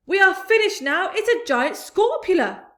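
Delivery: high, loud panicked cry. A narrator's reading. Fire chief panicking on the rooftop. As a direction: wail it in terror.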